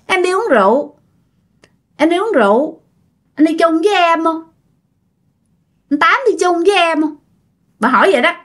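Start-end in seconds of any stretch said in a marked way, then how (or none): 0.1-7.2 high-pitched, eerie ghost voice; creepy ghost woman. Acted. Ghost inviting Uncle Tám to come along, creepy high voice. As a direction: lure him along in a high, haunting voice. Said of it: "cái giọng nghe rất là rùng rợn".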